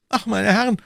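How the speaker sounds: high, loud plea for help; panicked cry. A narrator's reading. Shopkeeper bursting in on the gentlemen, crying out. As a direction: cry out in desperate alarm. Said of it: "schrie er".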